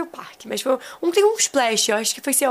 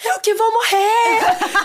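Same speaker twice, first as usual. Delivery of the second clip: mock scared wail; 10 semitones higher, much slower than usual. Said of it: "um brasileiro atrás falou assim".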